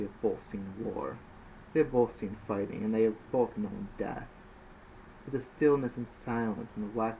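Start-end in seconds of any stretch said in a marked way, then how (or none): none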